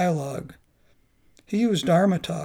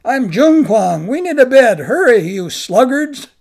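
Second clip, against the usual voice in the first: loud demand; 5 semitones higher, 11 dB louder than usual. Acted. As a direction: bark orders at the door. Demanding a bed at night.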